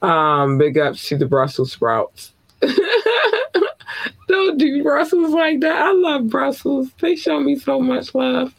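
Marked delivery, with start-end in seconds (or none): 2.6-4.2 a very high real laugh that falls away; burst of laughter. Real, not acted. Host laughing at his Brussels sprouts pun, high laugh. 4.3-8.5 much higher, still laughing through it; amused. Real, not acted. Host defending Brussels right after his joke, high and amused.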